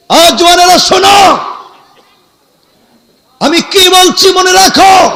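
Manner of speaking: high, loud rallying call; shouted exhortation. Real, not acted. Preacher calling young men to listen, shouted exhortation. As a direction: command attention across a big crowd.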